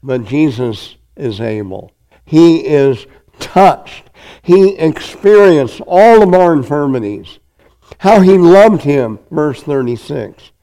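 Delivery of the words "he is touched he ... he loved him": rising preaching fervor. Acted. Preacher building to a peak.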